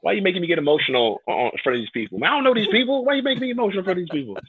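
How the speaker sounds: high, loud, hammed-up pleading; mock outrage. Guest complaining to Steven about getting emotional in public, mock outrage. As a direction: protest comically at being made emotional. Pretend phone call scolding Steven.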